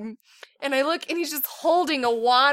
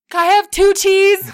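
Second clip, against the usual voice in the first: loud, higher mock kid voice; pleading child; 7 semitones higher, 10 dB louder than usual. Son back at mom's door, loud imitated child request. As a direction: imitate a kid asking eagerly for more. Retold son asks for more.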